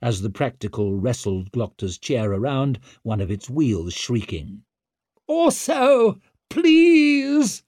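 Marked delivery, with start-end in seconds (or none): 5.3-7.6 very high, loud, stretched plea; shrill parting scream for mercy; desperate wail. Acted. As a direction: cry out begging after him. Wetterland wails as king leaves.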